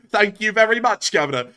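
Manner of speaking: high, loud geezer thanks; Brexit geezer marmot. Pretend. Accepting co-host's compliment, playful geezer voice. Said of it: "the accent of the marmot is that of a Brexit geezer".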